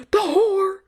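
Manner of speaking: high, loud, breathless gasped cry; dramatic whisper. Pretend. Vlogger hams up the word horror for laughs. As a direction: gasp it like a melodrama heroine. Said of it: "I'm not talking like that".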